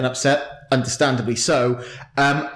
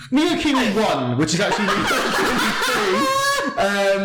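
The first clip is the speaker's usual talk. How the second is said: giggling through the title; laughing; 9 semitones higher than usual. Real, not acted. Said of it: "I can't say it".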